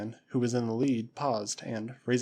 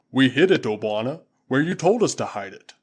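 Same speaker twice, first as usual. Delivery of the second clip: booming Waziri warrior; 7 dB louder than usual. Acted. Usula answering his master Tarzan, loud and proud. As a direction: call it out like a loyal tribesman.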